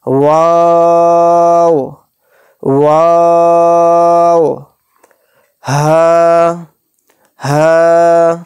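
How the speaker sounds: each letter name drawn out long and chanted; slow tajweed-style letter chant; stretched recitation. Shown as a demonstration. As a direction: hold the vowel for three alif counts. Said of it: "تین الف کی مقدار ہم کھینچ کر پڑھیں گے".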